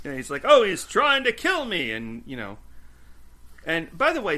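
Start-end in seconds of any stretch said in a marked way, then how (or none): 0.4-1.9 high, loud quoted protest; mock outcry. Seth complains about the jelly donuts he's brought, mock outcry. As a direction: blurt it out in mock alarm. Voicing Seth's excited complaint.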